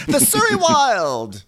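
high, slow theatrical voice; hammed-up haughty title reveal; grand movie title. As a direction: announce it with drawn-out mock grandeur. Joke movie titles in Karen's voice.

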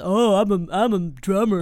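slow smug dude voice; voice for exes. Retold or imitated. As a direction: play the self-important ex. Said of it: "Your voice now is my voice for exes".

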